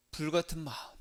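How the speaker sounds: ordinary talk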